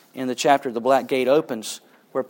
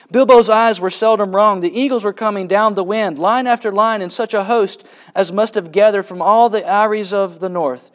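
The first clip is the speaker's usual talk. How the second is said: raised, higher, swelling storytelling voice; excited narration; 7 semitones higher, 8 dB louder than usual. A narrator's reading. Teacher reading the eagles' arrival, swelling narration. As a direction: let the joy carry the narration.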